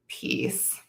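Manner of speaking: ordinary talk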